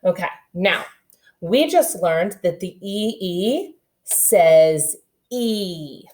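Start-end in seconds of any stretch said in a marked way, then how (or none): 3.2-6.0 slow "E says E" with the E drawn out; long e sound. Shown as a demonstration. Teacher slowly modeling the long e to the class. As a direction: draw out "E" as a long ee. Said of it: "that long E sound".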